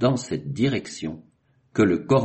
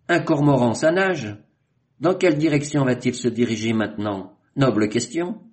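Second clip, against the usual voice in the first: higher, louder mock-puzzled asking; excited questioning; 5 semitones higher, 4 dB louder than usual. A narrator's reading. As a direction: raise the point with lively wonder. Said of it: "s'exclama Chinchar".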